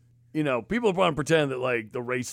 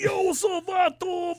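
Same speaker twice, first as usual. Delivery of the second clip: high mock-homie holler; mocking cashier; 4 dB louder, slower than usual. Second girl greeting him with "vato", high mocking holler. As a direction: imitate her taunting greeting in a high street voice.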